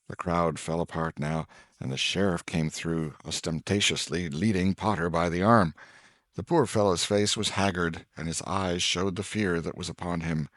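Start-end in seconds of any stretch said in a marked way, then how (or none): none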